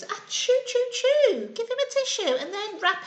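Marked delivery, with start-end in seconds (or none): 0.0-1.5 mock sneeze. Wolf sneezing.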